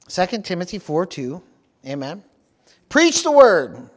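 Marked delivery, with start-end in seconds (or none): 2.9-3.9 loud, high, drawn-out preacher's yell. Real, not acted. Preacher reading a favorite scripture to the congregation, yelling. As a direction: shout it out with slow, full force. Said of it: "I can't see it without yelling it".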